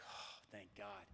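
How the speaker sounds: very soft, slow, low sigh of relief; quietly relieved, drawn out and dropping low; hushed relief. Retold or imitated. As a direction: breathe out your relief under your breath. Acted relief that she is gone.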